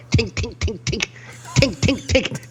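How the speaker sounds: high, light tink of a coin on glass; imitated coin taps. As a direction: tap it out like quarters on a counter. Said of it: "tap their quarters on the glass".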